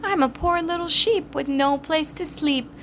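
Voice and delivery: wolf faking a lamb's sweetness; wolf pretending to be a lamb, sweetly; sweet little voice. A narrator's reading. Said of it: "in a sweet little voice".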